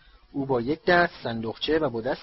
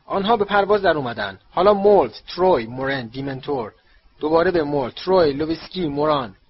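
louder, raised commentator's call; shouted commentary; 2 semitones higher, 9 dB louder than usual. A narrator's reading. Bagman following the players in flight, shouted commentary. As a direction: call the play name by name. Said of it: "بگمن فریاد زد".

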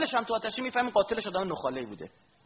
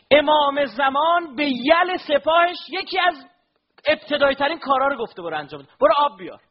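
higher, louder impassioned sermon; fervent preaching; 11 semitones higher, 10 dB louder than usual. Acted. Preacher stirring mourners with Abbas's story, fervent and loud. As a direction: preach it out with fire to the crowd.

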